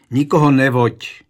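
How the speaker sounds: loud, strict command; stern refusal. A narrator's reading. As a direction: forbid it firmly and loudly. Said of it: "odmítl to Přemysl přísně".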